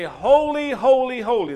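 loud, high cry of holy; worshipful cry. Acted. Angels before the throne crying out holy, loud and high. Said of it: "they say".

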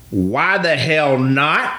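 steamy demand. Retold or imitated. Casting director pressing the actor about the movie, slow and heated. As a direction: stretch each word out like a suspicious boss. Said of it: "She's a little steamy".

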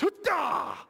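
high, loud gasp like a sucker punch to the gut; mock winded gasp. Groom seeing his bride, mock gasp of being winded. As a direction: play the groom getting the wind knocked out. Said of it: "The wind just got knocked out of him".

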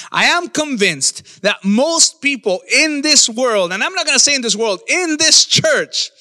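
forceful sermon delivery with stretched key words; emphatic preaching. Real, not acted. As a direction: preach with rising conviction, pausing hard for weight. Preacher drives home the final point.

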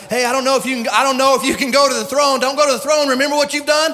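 loud, high accusing devil; taunting devil. Acted. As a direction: goad them loudly as the devil. Acts out devil taunting the believer.

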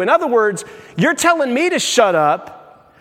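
normal talking voice

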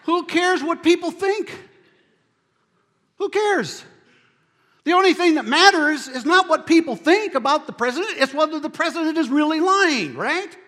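loud, high-pitched preaching shout; full-force rhetorical challenge; indignant outburst. Real, not acted. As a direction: raise the voice in emphatic dismissal. Preacher indignantly dismissing opinion polls.

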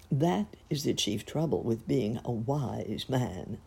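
ordinary delivery

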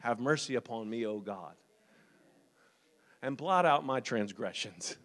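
low, hushed prayer. Shown as a demonstration. Preacher showing how to pray through the text, hushed prayer. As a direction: pray it softly and low, with pauses. Prays a psalm as example.

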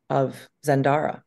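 normal talking voice

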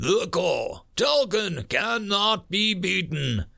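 high-pitched, resolute recitation; defiant marine creed. Acted. Marine recites his creed to himself before dying, defiant. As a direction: steel yourself with each measured phrase.

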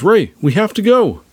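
high, loud plea to leave; urgent call. A narrator's reading. Poe hurrying Rey out during the attack, urgent call. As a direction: call out urgently over the chaos.

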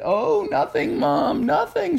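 put-on high, whiny boy covering up; a kid insisting nothing is wrong; high boy voice with a crying edge; nervous kid. A narrator's reading. Kid hiding the mess.

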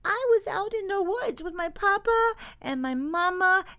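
high, tiny little-creature voice; baby beaver, forlorn. Acted. Baby beaver explaining how she got lost, forlorn. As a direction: tell the sad tale in a high baby voice. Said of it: "said the baby".

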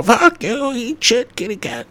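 sudden high shrieked outbursts; Tourette's tic impression. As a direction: blurt the curses out high like an uncontrollable tic. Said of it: "having Tourette's".

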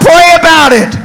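high, drawn-out preacher's shout; hollered call. Shown as a demonstration. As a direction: holler it out to rouse the congregation. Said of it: "Somebody holler out".

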